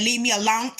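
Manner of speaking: "alone" ending in an added T. Shown as a demonstration. Showing viewers how she talks, "alone" pronounced with a final T. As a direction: put a hard T on the end of "alone". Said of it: "with a T".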